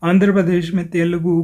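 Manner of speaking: normal talking voice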